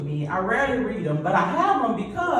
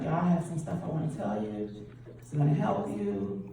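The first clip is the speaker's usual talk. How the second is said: dry monotone; 6 dB quieter than usual. Shown as a demonstration. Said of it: "I keep the same tone in my voice".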